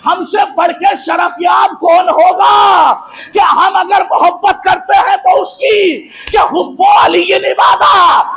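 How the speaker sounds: impassioned oratory building to ringing line ends; full-force preaching. Acted. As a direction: exhort the crowd, driving each phrase home.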